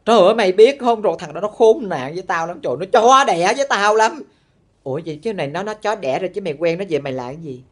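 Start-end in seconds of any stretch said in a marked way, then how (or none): 0.0-4.2 loud imitation of a friend's cursing rant; exaggerated indignant ranting; mock angry cursing. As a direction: curse out the ex, hammed up and loud. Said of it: "nó chuyển sang nó chửi".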